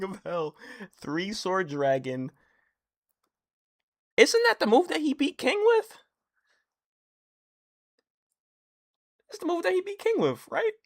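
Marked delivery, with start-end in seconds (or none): 4.2-10.7 much higher, louder, racing; can't believe he spotted it; excited. Real, not acted. Fan recognising the move, high and excited.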